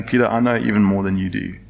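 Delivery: soft, quiet, tender reassurance; calm a frightened friend; Jesus gently answers the kneeling Peter; gentle Jesus. Acted. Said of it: "Jesus in his head going".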